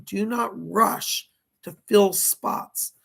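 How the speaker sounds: slow, each word of "do not rush to fill spots" spaced out with weight on "fill"; slow, deliberate quote. Retold or imitated. Medium relaying a spirit's exact words, slow and deliberate. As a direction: lay the spirit's words down slowly, one by one. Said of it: "this is his words, literally".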